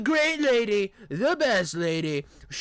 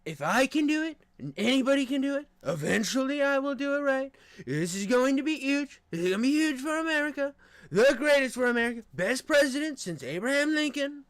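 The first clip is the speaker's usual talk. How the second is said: higher, bragging mock Trump voice; Trump impression; 6 semitones higher than usual. Streamer practices his Trump impression for chat, boastful and hammed up. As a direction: boast like Trump at a rally, hamming it up. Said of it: "Trump's hard".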